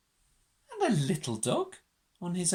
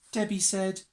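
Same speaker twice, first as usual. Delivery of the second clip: usual speaking voice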